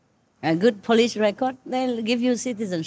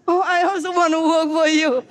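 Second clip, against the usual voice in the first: put-on like a baby; 7 semitones higher than usual. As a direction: plead like a baby without its milk. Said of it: "he's saying like a baby, you know, without milk".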